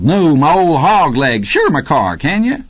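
high, teasing reply without real laughter; high-spirited, cackly banter; cackling. A narrator's reading. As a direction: crow it teasingly to an old buddy. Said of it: "Rocky cackled".

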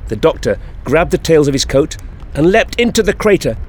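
ordinary delivery

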